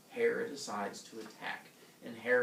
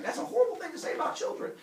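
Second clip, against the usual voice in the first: high, fast comic outrage; yelled rebuke; 4 dB louder, faster than usual. Retold or imitated. Villagers yelling back at Heracles, high indignant rush. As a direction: scold in a scandalized high rush. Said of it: "they yell back and forth".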